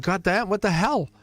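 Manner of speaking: high, outraged mock cry; exasperated disbelief. Partner left by her, exasperated and baffled. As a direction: play the baffled one who got left. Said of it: "And you're like".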